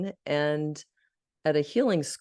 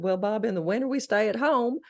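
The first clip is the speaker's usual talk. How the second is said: put-on Southern accent; 7 semitones higher than usual. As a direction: lay on the Southern accent for the host. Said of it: "I was in a Southern accent".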